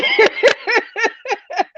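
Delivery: a high giggling burst; high laughter. Real, not acted. Host breaks up again over the troll page, high giggle.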